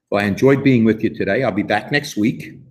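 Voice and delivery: ordinary delivery, nothing marked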